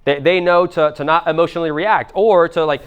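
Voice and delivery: ordinary talk, nothing marked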